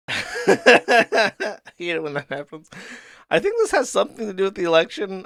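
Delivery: laughing. Real, not acted. Host baffled by the comic, really laughing as they talk. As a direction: laugh helplessly, pitch lifting as you speak.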